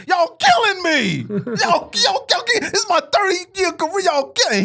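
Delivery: hammed-up high-pitched wailing; mock a tearful meltdown, voice shooting up high; R. Kelly crying. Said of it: "when he starts crying and he goes".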